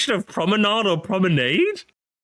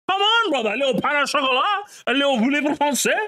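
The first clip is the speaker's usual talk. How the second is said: mock French pleading; 4 semitones higher, faster than usual. Teasing coaxing with French words.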